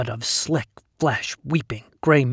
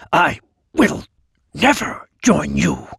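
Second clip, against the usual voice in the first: spitting; 4 semitones higher, 5 dB louder than usual. Acted. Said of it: "I spit".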